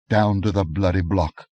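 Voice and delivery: ordinary delivery, nothing marked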